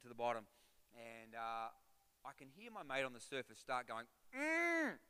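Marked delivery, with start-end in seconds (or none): 4.4-5.0 high hummed mm through a snorkel; mimicking a mate's underwater hum; imitated muffled call. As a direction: hum high to get his attention. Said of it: "making a loud noise".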